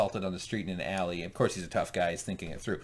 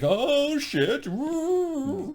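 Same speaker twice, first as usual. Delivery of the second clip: very high startled yelp; 4 dB louder, much slower than usual. Retold or imitated. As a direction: caught out, squeal it high. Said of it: "And he's like".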